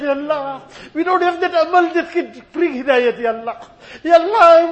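loud, fervent prayer, each plea ending on Ya Allah; pleading dua. Acted. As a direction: beg God with rising passion, voice straining. Impassioned closing dua.

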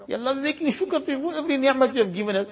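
ordinary talk

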